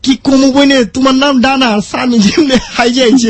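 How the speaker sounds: Dhakaiya dialect, h for sh; Old Dhaka accent. Acted. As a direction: talk like an Old Dhaka local. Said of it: "আমিও ঢাকার ভাষায় কথা বলতে পারি".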